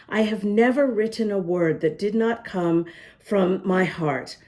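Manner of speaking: usual speaking voice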